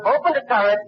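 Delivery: high, breathless rush on "opened the turret"; excited retelling. Retold or imitated. Fan retelling the tank scene to Bogart, high and excited. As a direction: get swept up as the hero reaches the tank. Climax of retold battle scene.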